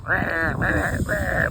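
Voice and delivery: imitated squirrel growl. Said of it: "That thing's growling".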